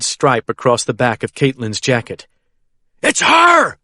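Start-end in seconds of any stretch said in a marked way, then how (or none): 3.0-3.8 high loud scream. A narrator's reading. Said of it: "I scream".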